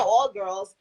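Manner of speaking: usual speaking voice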